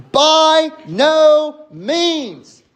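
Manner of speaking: slow, high and loud, every word punched; a drawn-out, ringing refusal; emphatic no. Retold or imitated. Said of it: "as emphatic a no as he possibly can".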